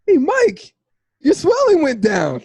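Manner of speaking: high, loud burst of excitement; delighted greeting, voice leaping high; excited news. Retold or imitated. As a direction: blurt it out thrilled, pitch jumping up. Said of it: "I was like".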